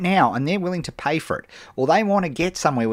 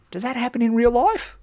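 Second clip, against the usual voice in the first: ordinary delivery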